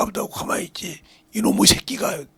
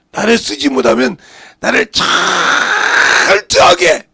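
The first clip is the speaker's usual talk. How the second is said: high, very loud pulpit cry; preaching shout; 7 semitones higher, 14 dB louder than usual. Real, not acted. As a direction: thunder it at the congregation. Preacher builds to a climax.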